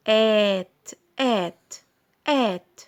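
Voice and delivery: آت as "at", open a, not "ay"; simple short sound. Shown as a demonstration. Teacher demonstrating A in two-letter words, simple sound. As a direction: repeat آت as an isolated word with pauses. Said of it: "نفس الشيء بشكل بسيط".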